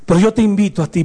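ordinary delivery

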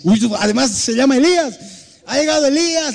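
high, excited welcome greeting; mock delighted welcome. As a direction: greet him like a long-awaited guest. Pastor voicing himself greeting blind man. (bienvenida alegre y aguda)

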